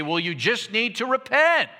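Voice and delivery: a hint of pat, superior advice; self-righteous know-it-all. Preacher mimics a know-it-all advising the sufferer, lightly self-righteous. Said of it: "self-righteous know-it-all".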